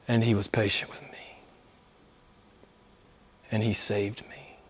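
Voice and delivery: a whispered testimony of grace; hushed whisper. Real, not acted. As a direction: drop to a near whisper. Personal testimony, softening to awe.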